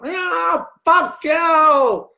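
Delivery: high, loud squawking parrot shout. Retold or imitated. A parrot next to the old man swears at them, high squawking shout. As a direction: screech it like a cussing bird. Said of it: "right next to him's going".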